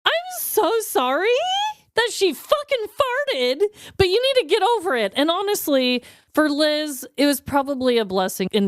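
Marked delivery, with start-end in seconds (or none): 0.1-5.1 high sarcastic apology, then a rushed scold; mock-sympathize, then hurry the brush-off; mock telling-off. Host scolding the ex about the fart, high and sarcastic.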